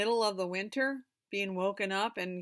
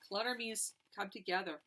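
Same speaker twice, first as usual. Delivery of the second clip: ordinary delivery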